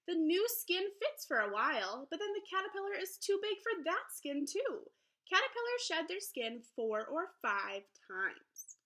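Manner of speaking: lively, sing-song picture book read-aloud; reading to children. Acted. Teacher reading a butterfly book to her class, lively storybook voice. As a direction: read it brightly to young listeners.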